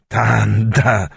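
lightly voiced old man calling back; old man's exclamation. A narrator's reading. Old Аякс calling back to Танда, mild exclamation. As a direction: answer the greeting with a drawn-out name. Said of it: "вскрикнул в ответ старик".

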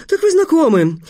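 high-pitched burst of delight; delighted exclamation. A narrator's reading. Teacher learning the pupils know each other, high-pitched delight. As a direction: exclaim it, thrilled by the discovery. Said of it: "восхитился учитель Апф".

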